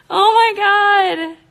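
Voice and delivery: high, loud, drawn-out cry; overjoyed scream. Real, not acted. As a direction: wail it slowly in stunned joy. Overjoyed by the score.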